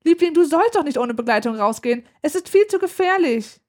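put-on worried mother warning her child; agitated mother. A narrator's reading. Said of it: "Aufgebracht läuft meine Mutter".

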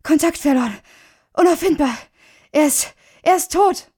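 high, loud, breathless distress; gasping for air. A narrator's reading. Woman bursting into the office with terrible news, breathless. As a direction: blurt it out in gasped fragments. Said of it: "Sie schnappte nach Luft".

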